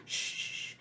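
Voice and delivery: a whispered hush; retold whispered shush. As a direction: hush him like a scared big sister. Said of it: "And I'm just like".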